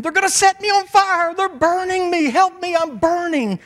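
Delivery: very high, loud reenacted scream; screaming in terror. Pastor acting out the hippie who believes he is burning, screaming. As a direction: shriek for help, acting out someone on fire. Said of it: "the guy started screaming".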